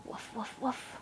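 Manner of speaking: woof woof whispered, no voice. Shown as a demonstration. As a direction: breathe woof woof softly. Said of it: "What's a dog say?".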